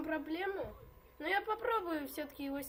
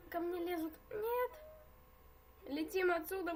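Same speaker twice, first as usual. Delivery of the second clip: ordinary delivery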